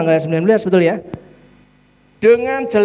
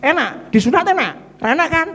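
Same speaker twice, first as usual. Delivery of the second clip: normal talking voice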